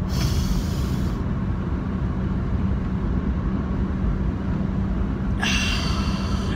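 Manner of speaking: a long, drawn-out audible breath out; empty the lungs slowly; long slow exhale. Shown as a demonstration. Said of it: "Here we go".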